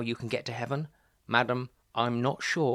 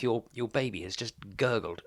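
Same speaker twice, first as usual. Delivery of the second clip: usual speaking voice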